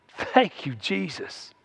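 breathy, hushed thank-you to God; whispering thanks to Jesus after the verse; sighed whispered thanks. Real, not acted. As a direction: sigh it out softly.

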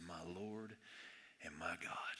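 almost-whispered confession; soft awe. Retold or imitated. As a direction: let the words barely come out. Repeated confession, softer.

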